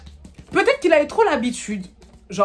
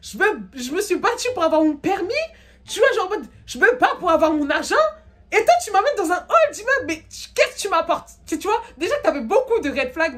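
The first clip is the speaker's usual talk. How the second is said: much higher, exasperated venting at him; indignant rant; 8 semitones higher than usual. Retold or imitated. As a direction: let it rip, outraged and half laughing. Rant at the date. (tirade indignée, voix très aiguë)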